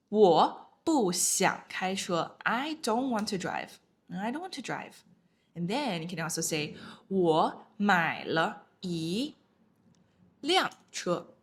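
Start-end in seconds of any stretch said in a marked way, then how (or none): 0.1-2.4 slow, clear example sentence; slow model sentence. Shown as a demonstration. Teacher giving an example to students, slow and careful. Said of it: "let's look at some examples". 7.1-11.3 我买了一 辆车 slow, rising on 辆车; slow model sentence. Shown as a demonstration. Teacher showing the tone of 辆 to students, slow. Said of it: "fourth tone".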